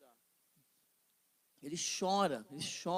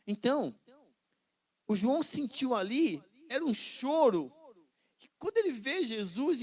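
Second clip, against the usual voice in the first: louder, higher sermon delivery; fervent preaching; 5 semitones higher, 5 dB louder than usual. Acted. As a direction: preach it with fire to the congregation. Preaching at full force. (pregação fervorosa e mais alta)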